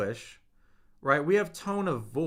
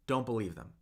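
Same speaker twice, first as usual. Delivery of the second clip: normal talking voice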